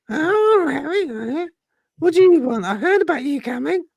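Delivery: tiny high squeaky mongoose voice; Jeff's little voice. Acted. Talking mongoose greets the investigator in a tiny high voice. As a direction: pitch it way up like a small talking animal. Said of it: "this little voice".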